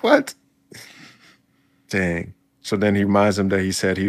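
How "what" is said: sudden high, loud yelp of disbelief; shocked what. Retold or imitated. Reacting to the betrayal reveal with a shocked yelp. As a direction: jolt up into a stunned squeak. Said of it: "I'm like".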